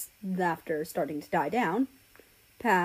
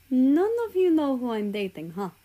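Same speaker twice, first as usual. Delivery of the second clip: teasing character voice; 5 semitones higher, 3 dB louder than usual. Acted. Russia teases the group.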